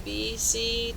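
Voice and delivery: usual speaking voice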